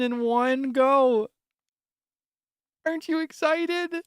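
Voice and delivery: overexcited game host voice; hyped-up cheer. Acted. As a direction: sell it like a giddy kid. Cheerful farm game hype voice.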